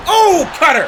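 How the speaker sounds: high loud burst; excited shout. Real, not acted. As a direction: pop on the move name. Sudden finisher.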